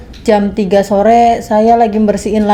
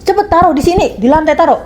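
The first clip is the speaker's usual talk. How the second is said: higher-pitched mock command; retold angry demand; 6 semitones higher than usual. Employer demanding the maid's phone, imitated higher. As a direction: bark out the boss's demand.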